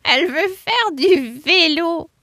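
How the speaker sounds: high, slow clucky hen voice; a gossipy hen telling the news; chatty hen. Acted. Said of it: "répondit la poule la plus bavarde".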